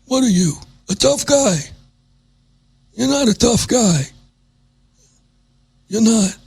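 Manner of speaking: high put-on mobster voice taunting; mock mobster. Taunting the tough guy host in a put-on mobster voice. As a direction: sneer it like a movie gangster.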